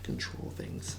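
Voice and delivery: breathy whispered hint. Real, not acted. Co-host sneakily whispering the answer to his partner, whispered.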